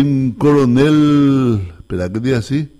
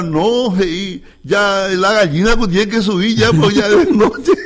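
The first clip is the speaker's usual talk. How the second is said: higher-pitched woman's voice, breaking into laughter; imitated woman; 5 semitones higher, slower than usual. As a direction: mimic her protest in a high, amused voice. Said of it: "y dijo la señora". (imitando a una señora, voz aguda y risueña)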